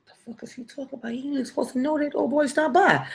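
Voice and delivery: hushed quick thinking voice; inner panic. Retold or imitated. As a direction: mutter it under your breath, fast.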